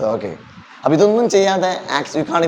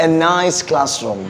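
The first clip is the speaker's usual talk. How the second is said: "nice" drawn out slowly; expressive stress; much slower than usual. Shown as a demonstration. Teacher models English for the class, nice lengthened. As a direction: stretch nice so the niceness comes through. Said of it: "നല്ല എന്ന് പറയുമ്പോ".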